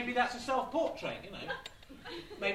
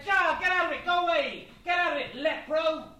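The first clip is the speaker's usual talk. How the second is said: loud, high, hammed-up angry yell; shooing someone off; 6 semitones higher, 7 dB louder than usual. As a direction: yell him away like a nuisance. Comic shooing away a leper tramp.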